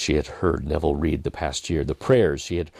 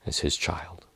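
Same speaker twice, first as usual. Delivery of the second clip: whispered; 7 dB quieter, slower than usual. A narrator's reading. Kneeling before God.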